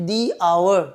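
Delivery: "দি" with a long ee before "আওয়ার"; THE as "dee". Shown as a demonstration. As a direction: say "দি আওয়ার" with silent h. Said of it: "দি উচ্চারণ করা হচ্ছে". ("the"-কে "দি" উচ্চারণ)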